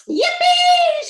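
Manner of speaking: very high, loud whoop; joyful shout. A narrator's reading. Grandpa Joe whooping as the lift shoots up. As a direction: whoop with glee. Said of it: "shouted Grandpa Joe again".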